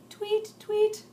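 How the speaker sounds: high chirpy tweet; baby bird in the tree, high tweeting. Acted. Bird sound in story.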